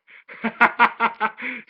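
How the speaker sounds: real laughter at the absurd number; high burst of laughter. Real, not acted. Reviewer cracks up at Android eighteen's score, loud laugh.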